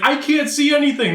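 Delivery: high-pitched frantic calling out to teammates; imitated panicked yell. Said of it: "like, yelling".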